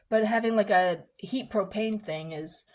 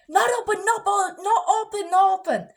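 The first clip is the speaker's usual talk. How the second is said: high, loud, choppy repeated refusal; agitated non-English speaker imitation; 10 semitones higher, 5 dB louder than usual. Worker refusing mom's call, hammed-up agitated imitation. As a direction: imitate a flustered shop worker cutting in.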